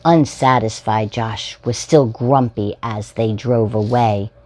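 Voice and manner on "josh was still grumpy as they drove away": usual speaking voice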